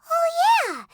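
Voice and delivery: high, bright exclaim; excited realization. Acted. Paimon suddenly remembers, excited. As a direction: pop up with the idea.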